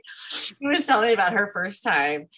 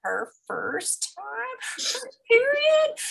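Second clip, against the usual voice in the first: gossiping grandma; 2 semitones higher than usual. Retold or imitated.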